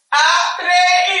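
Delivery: loud, high shout; shouted reply. Retold or imitated. Yaneli shouting back to the running friend, loud. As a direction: call it out across the distance.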